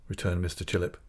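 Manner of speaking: ordinary delivery, nothing marked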